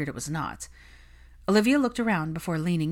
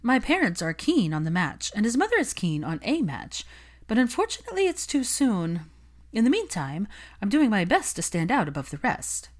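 higher, self-assured gossip voice; higher, confident Olivia talking up her match; scheming socialite; 5 semitones higher than usual. Acted. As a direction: lay out the plan like it is obvious.

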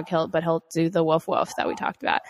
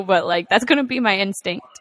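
ordinary talk, nothing marked

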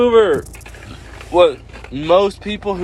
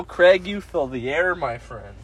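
usual speaking voice

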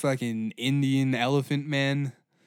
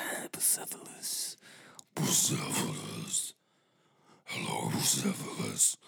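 crazy horseman; 8 dB quieter than usual. Acted. Alexander murmuring lovingly to his horse, deep and hushed. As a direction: croon low to the horse like a madman. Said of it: "my impression of a crazy horseman".

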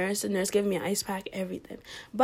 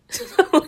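laugh; 9 semitones higher, 6 dB louder than usual. Real, not acted. Laughing at own story.

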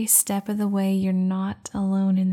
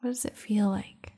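guided meditation. Acted. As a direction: guide them into calm, unhurried. Leads a meditation.

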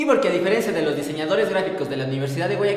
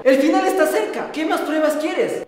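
higher, dramatic doomsday warning; doomsday preacher; 6 semitones higher than usual. Acted. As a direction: preach that the end is near.

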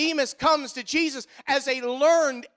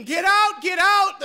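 mock yell; 7 semitones higher, 6 dB louder, slower than usual. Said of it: "comes running out of that bathhouse screaming".

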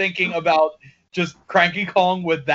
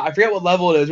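ordinary talk, nothing marked

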